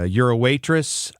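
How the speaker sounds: normal talking voice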